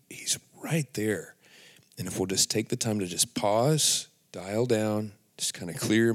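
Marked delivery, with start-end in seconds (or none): 0.1-1.4 hushed and slow; slow whisper. Real, not acted. Reminding viewers God is near, a slow whisper. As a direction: breathe it out gently.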